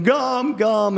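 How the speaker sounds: put-on high whining toddler; little girl at checkout wailing for gum, high; tantrum child. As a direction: wail it like a tantrum. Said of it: "throws even more of a tantrum".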